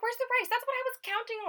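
high, fast whining question; high panicked mock whine. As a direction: squeak out the panic in mock despair. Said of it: "I was like".

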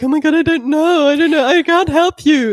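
high, mock-frantic whine; mock panicky helplessness. Mocking helpless person.

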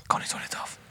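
whispering. Acted. Echoing the meme title.

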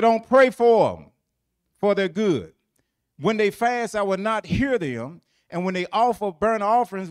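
loud, driving scripture recital; forceful pulpit reading of God's words; preaching at full force. Acted. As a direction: preach the verse out hard.

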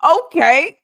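high, loud yelled okay; [name] okay. Retold or imitated. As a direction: shout it like a hype man. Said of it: "how does Little John go".

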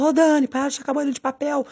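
high-pitched mock telling-off; imitated scolding. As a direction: imitate someone crossly scolding a friend. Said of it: "a pessoa me xingava". (bronca imitada, em voz aguda)

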